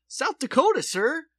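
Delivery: put-on high, loud New Yorkist accent. Answers the dad where she's from, high New Yorkist accent. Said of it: "in the New Yorkist accent".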